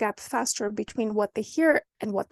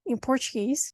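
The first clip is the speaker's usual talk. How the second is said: normal talking voice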